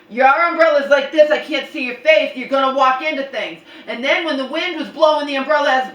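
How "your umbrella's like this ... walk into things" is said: loud scolding. Retold or imitated. Arguing with mom.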